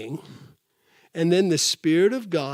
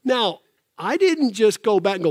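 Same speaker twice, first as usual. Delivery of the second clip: normal talking voice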